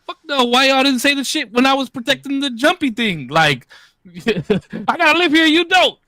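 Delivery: loud, high, indignant rant; loud, high yelling at the other parents; angry retold rant. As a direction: shout them down like the mean dad.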